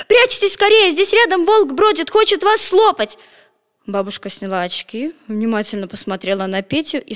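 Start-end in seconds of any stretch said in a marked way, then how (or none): 0.1-3.2 much higher, louder warning shout; urgent shout. A narrator's reading. Petya shouts a warning to the grandmother, loud. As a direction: yell from the doorway. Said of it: "закричал".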